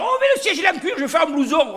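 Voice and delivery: voix très aiguë et plus forte, ravie de l'aubaine; pilleur tout excité. Acted. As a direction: crie la trouvaille du siège en cuir, bien plus haut. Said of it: "Putain, ils disent". (un pilleur s'extasie devant le siège en cuir, voix perchée criée)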